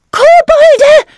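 high-pitched, loud shriek; frightened scream. A narrator's reading. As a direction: cry out in shrill alarm. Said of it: "schrien sie".

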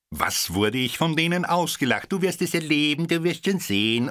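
high, jeering taunt; sneering prophecy of doom; mocking jeer. A narrator's reading. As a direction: scoff at her like catty colleagues. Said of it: "unkten und spotteten sie".

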